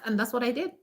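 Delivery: usual speaking voice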